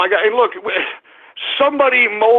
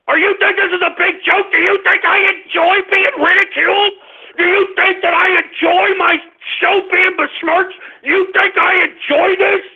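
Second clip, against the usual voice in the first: high-pitched, indignant tirade; outraged rant; 7 semitones higher than usual. Real, not acted. Host asking trolls if he enjoys ridicule, outraged rant. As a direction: hurl each question at the trolls. Rages at trolls mocking him.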